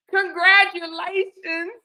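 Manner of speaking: high, drawn-out singsong cheer; gushing congratulations. Retold or imitated. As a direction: gush it like delivering happy news. Repeats the lady's cheerful news.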